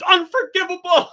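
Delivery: high, loud hammed-up outrage; mock outrage. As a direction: declare it like a wounded drama queen. Voicing NPC's outraged reaction.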